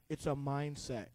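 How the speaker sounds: low, soft mock-serene reply; hushed, maddeningly composed; calm brother. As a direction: drop low and quiet as the unbothered sibling. Calm brother acted, against outburst.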